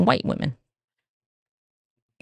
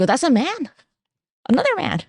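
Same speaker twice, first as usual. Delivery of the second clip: mock amazement; 9 semitones higher than usual. Acts spotting rare male viewers.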